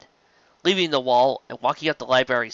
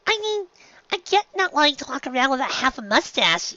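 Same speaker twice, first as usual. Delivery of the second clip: small filly, puzzled and hesitant; curious filly; 12 semitones higher than usual. Acted. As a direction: ask shyly like a young girl. Filly reasons.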